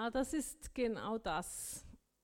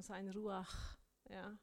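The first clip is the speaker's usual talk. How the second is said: ordinary talk, nothing marked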